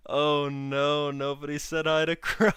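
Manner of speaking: loud, high mock outrage; nobody greeted Crow, loud mock alarm; mock dismay. Real, not acted. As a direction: cry out in playful dismay. Mock dismay over missed greeting.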